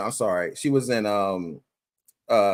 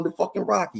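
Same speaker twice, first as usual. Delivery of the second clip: imitated Italian accent, higher pitch; 6 semitones higher than usual. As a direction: mimic a comic doing an Italian accent. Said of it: "he's doing the Italian accent".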